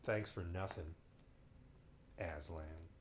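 dry, soft sarcastic thanks; deadpan it under your breath; sarcastic mutter. Pretend. Reader mocking Aslan's vague answer, dry and soft. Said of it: "That's a sick dodge".

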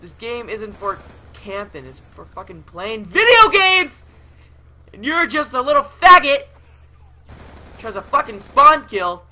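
high rant building to full yelling; furious rant. Real, not acted. Gamer scolding a camper and spawn killer, furious shouting. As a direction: let real rage climb into a shout.

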